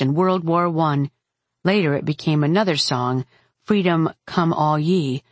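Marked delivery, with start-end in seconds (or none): none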